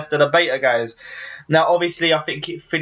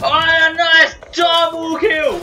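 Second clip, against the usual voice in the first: excited; 12 semitones higher, 3 dB louder, slower than usual. Real, not acted. Celebrates kill.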